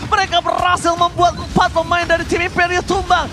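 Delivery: rising hype caster call; fighting game announcer. Real, not acted. Hype esports caster play-by-play.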